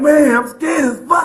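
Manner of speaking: slow, loud cry of fear; terrified shout. Real, not acted. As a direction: shout out in real terror. Man panicking before getting in car.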